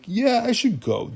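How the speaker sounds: high, drawn-out mock excuse; awkward exit. John Smith leaving after a close call, awkward.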